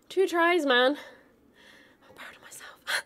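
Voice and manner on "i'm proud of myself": very soft, voiceless self-praise; whispered pride. Real, not acted. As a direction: whisper it to yourself after the win. Self-praise after the win.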